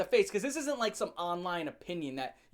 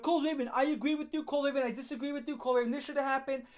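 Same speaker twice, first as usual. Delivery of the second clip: quick higher read of sample comments; 6 semitones higher, much faster than usual. Shown as a demonstration. Vlogger modelling comments for viewers, quick and higher. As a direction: rattle them off like typed comments. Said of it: "Type it up".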